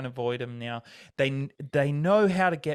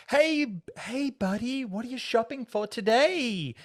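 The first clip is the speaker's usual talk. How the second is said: much higher, bright salesy hailing voice; chirpy fundraiser; 11 semitones higher than usual. Retold or imitated. Mall fundraiser calling out to a passing shopper, overly chirpy. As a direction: hail a shopper with fake friendliness.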